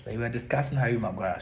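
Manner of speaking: normal talking voice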